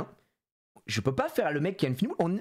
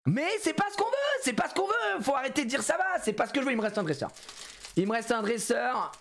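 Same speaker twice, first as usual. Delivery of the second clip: frustrated yelling; 7 semitones higher than usual. Real, not acted. Frustrated at pack again.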